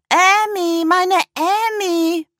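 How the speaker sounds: high, loud, slow honking cry. A narrator's reading. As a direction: bray it out joyfully, stretched. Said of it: "trötete er".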